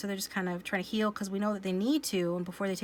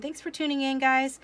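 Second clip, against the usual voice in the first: cheery sign-off; 6 semitones higher, 5 dB louder than usual. Real, not acted.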